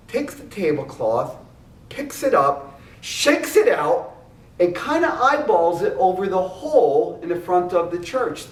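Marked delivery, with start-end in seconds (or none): none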